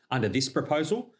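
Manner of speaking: ordinary talk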